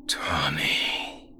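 eerie breathy monster whisper; dark whisper behind you. Acted. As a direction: whisper his name from the shadows. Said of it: "the shadows whispered".

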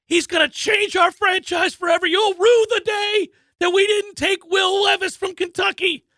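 a scout selling the pick with total bravado; shouting the pick's praises; hyped shouting scouts. Retold or imitated. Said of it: "are like".

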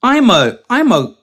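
high, loud mock dog talk; squeaky-high talking dog. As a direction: put on a high dopey pet voice. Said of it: "But it was like".

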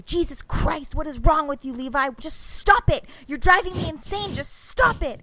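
high-pitched retold mom meltdown; acting out losing it at a child; loud exasperated outburst. Mom snapping at son before everybody, loud exasperated scolding. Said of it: "I said so loud in front of everybody".

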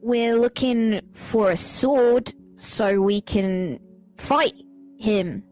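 ordinary talk

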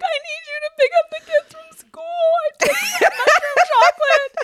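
high-pitched, fast, panicky plea breaking into a laugh; frantic retelling. Retold or imitated. Wife telling husband she took mushrooms, frantic high squeal. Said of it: "And I was like".

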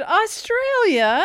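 high, drawn-out excited cry; laughing, high-pitched excitement. Shown as a demonstration. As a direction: say AUSTRALIA high and stretched, with excitement. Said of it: "I was trying to sound excited".

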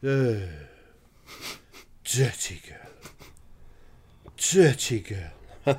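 put-on slow, drawn-out disapproving old Welsh lady; imitating chapel nan. Chapel-going nan tutting at a TV scandal, slow. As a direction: tut it out slowly like a prim grandmother. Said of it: "just says to my mum".

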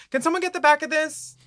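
high-pitched mock diva request; put-on high voice for a needy queen; contestant calling for help. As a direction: call out high, imitating a fussing contestant. Imagined contestant calling for help backstage.

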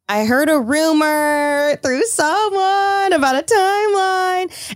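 high, drawn-out teasing singsong; teasing hint. Retold or imitated. Speaker retelling how she hinted to friends, teasing singsong.

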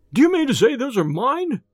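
high-pitched agitated question; indignant cry. A narrator's reading. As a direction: cry it out, affronted. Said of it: "he cried".

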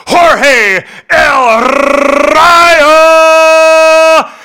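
booming, high ring-announcer shout with rolled R's; full-force boxing announcer, drawn-out names. Shown as a demonstration. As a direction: stretch out key words like a big fight intro. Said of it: "you really turned on the voice".

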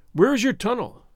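plain reading voice

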